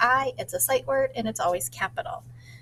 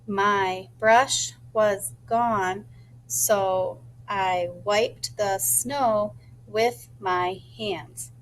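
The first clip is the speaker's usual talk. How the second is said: slow rehearsing; 2 semitones lower, much slower than usual. Shown as a demonstration. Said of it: "I wonder how many words that is".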